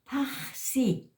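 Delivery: high PAXI with a long breathy rasp on the x; aspirated J. Shown as a demonstration. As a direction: let air rush through the x of PAXI. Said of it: "con la H".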